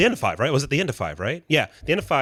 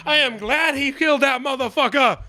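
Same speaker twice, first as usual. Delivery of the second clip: ordinary delivery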